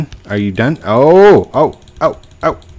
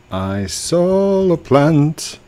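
higher, slow, stretched-out announcement; drawn-out singsong; 7 semitones higher, much slower than usual. Pretend. Player excitedly announcing the plant they found, slow singsong. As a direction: sing it out slowly like a happy discovery.

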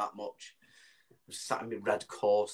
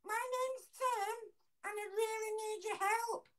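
put-on baby voice; 4 dB quieter than usual. Prank child introducing himself to bloke, squeaky baby voice. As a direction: plead like a tiny helpless child. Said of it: "The, the baby voice".

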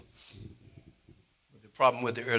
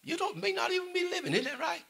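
apostle James scolding. Retold or imitated. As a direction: chide the boasters gently. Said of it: "He said".

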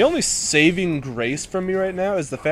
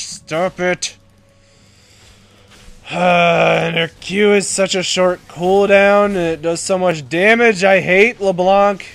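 frustrated rant; 4 semitones higher, 7 dB louder, slower than usual. Real, not acted. Losing a lane to LeBlanc.

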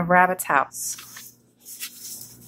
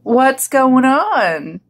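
higher-pitched, stretched question; puzzled iguana; 4 semitones higher, 4 dB louder, much slower than usual. Acted. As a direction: ask very slowly in a raised character voice. Iguana voiced in the story.

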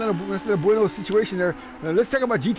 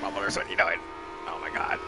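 very low, quiet put-on voice; low-voiced dismay; 12 semitones lower, 8 dB quieter than usual. Commentator to erring driver, low mock dismay. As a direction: drop far down in pitch and soften.

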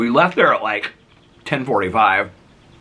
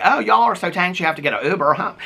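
playfully ribbing, pitched up; retold mock accusation, higher voice; teasing; 8 semitones higher than usual. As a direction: re-enact giving friends a hard time. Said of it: "I gave them such a hard time".

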